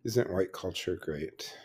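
deadpan the mock praise, quietly; sarcastic. Real, not acted. Host on whiteness at work, dry sarcasm. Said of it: "I say with all the sarcasm".

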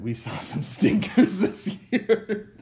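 high, loud, slow burst through laughter; laughing. Real, not acted. Co-host admitting the year had stinkers, laughing. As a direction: crack up while saying it. Breaks up while mocking bad movies.

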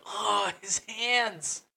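high, slow, breathless gushing; swooning fangirl. Retold or imitated. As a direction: gush like a swooning fan. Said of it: "people are like".

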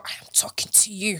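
unvoiced stern whisper. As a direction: hiss it under your breath. Imitating mother calling child.

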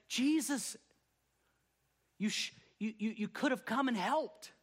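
disappointed plea. Retold or imitated.

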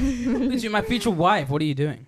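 Lisa high and loud, laughing through it; high-start Tommy impression. Shown as a demonstration. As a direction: overdo the swoop from the top. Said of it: "he either starts really high or he starts really low".

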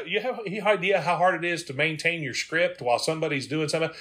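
normal talking voice

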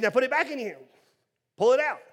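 high, loud order; commanding God. Acted. Preacher voices God telling Moses what to do, high and commanding.

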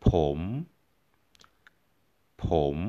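ผม said with a rising tone; ผม with the pitch sliding up. Shown as a demonstration. Teacher modelling ผม for learners, rising tone. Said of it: "with a rising tone".

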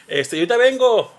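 loud, high call to someone not there. Retold or imitated. S0 pretending to talk to a roommate, calling out loud. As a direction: shout it so outsiders think someone is home.

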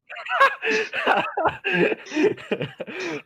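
high laugh. Real, not acted. Laughing at the request.

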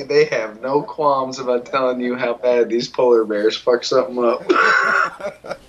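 ordinary talk

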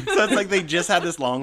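high, loud laughing; burst of laughter. Real, not acted. Host laughing about the wish spell. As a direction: explode with laughter into the words.

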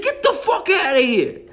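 very high, hammed-up indignant yell; mock angry dismissal. Outraged show character sends rival off, mock-angry. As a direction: imitate a comic character throwing someone out.

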